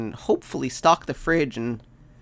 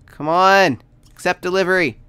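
normal talking voice

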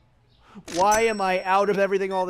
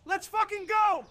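much higher, triumphant whoop; excited cheer; 12 semitones higher than usual. Real, not acted. Cheering his day-eight profit to his co-player, high and excited. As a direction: celebrate the win like a goal.

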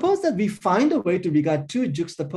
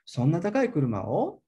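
"kuruma o" lifts into a question; rising on noun phrase; 2 semitones higher than usual. Shown as a demonstration. Lecturer shows a fragment question, rising on "kuruma o". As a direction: end "o" on a clear question rise. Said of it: "That kuruma o get the rising intonation".

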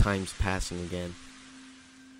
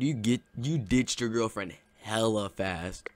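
usual speaking voice

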